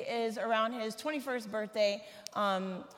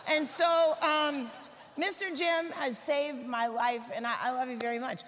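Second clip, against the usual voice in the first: ordinary delivery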